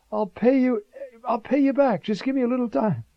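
a hint of a groveling servant; pleading servant. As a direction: beg for time like a desperate debtor. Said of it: "servant pleading, voiced".

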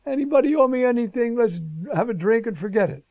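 a light mock of a cheerful drinker; happy-go-lucky servant. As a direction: play the freed man in a giddy mood. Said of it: "you'd think this guy'd be so happy".

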